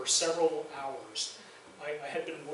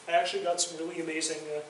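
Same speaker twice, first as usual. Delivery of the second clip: ordinary talk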